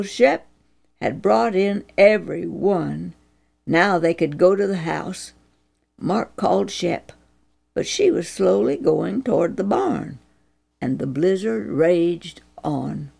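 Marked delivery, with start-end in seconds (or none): none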